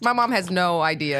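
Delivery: ordinary delivery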